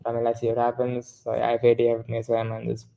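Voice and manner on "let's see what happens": ordinary talk, nothing marked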